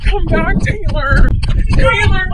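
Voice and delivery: loud high call across the yard; calling after child. Acted. Mom yells after runaway son Taylor, loud call. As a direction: yell after the kid running off.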